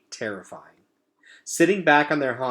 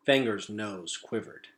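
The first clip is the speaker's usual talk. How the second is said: plain reading voice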